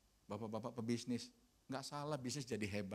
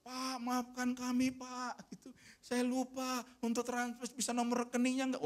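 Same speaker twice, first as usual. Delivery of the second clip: apologetic committee; 12 semitones higher, 6 dB louder than usual. Retold or imitated. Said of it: "dia bilang".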